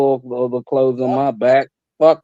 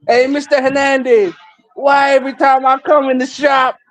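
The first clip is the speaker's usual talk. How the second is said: high complaining voice; 9 semitones higher than usual. Retold or imitated. Imitating customer complaint.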